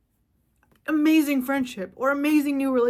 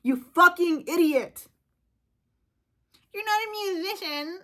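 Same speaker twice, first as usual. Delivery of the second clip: swearing rant, higher pitch; angry rant; 5 semitones higher than usual. Real, not acted. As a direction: tear into them. Ranting at AI users.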